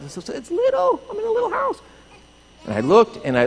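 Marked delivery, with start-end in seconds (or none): 0.1-1.8 very high falsetto; tiny squeaky voice. Acted. Man describes his small house, in a tiny squeaky voice. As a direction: squeak it out to sound little.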